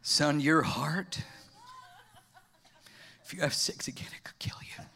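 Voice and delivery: mock-solemn deep doctor, fading out; grave doctor. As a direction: deliver terrible news gravely and hushed. Doctor delivering grave news.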